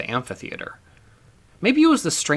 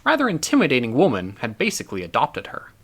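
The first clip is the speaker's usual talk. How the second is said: usual speaking voice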